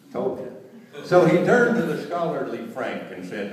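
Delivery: ordinary talk, nothing marked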